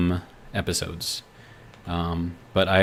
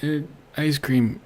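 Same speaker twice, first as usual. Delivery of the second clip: high, put-on voice of a confused president; presidential impersonation; 7 semitones higher, 5 dB louder than usual. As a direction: ham up a president losing the thread, with long halting pauses. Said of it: "in our best presidential, uh, impersonation".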